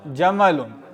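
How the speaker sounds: जमलुन with both A sounds short; short vowels. Shown as a demonstration. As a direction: say जमलुन without lengthening the middle vowel. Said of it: "और जमल जो है वो". (جَمَلٌ، بغیر الف کے)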